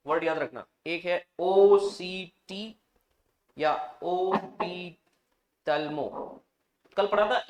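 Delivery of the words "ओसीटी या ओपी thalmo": very slow, letter by letter; spelled out slowly. Shown as a demonstration. Teacher to class spelling a word to remember, slow spelling. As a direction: draw out each letter with pauses between.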